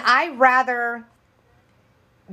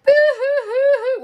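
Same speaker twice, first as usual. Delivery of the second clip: high exaggerated boo-hoo; mock crying; 4 dB louder than usual. Showing how she did not cry, mock sobbing. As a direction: ham up a loud, high fake sob. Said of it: "but not like".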